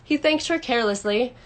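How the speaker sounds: ordinary delivery, nothing marked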